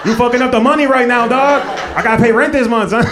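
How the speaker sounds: loud, high exasperated rant; mock angry scolding. Comic to friend risking his income, loud high outrage. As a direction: tell off a friend in comic panic.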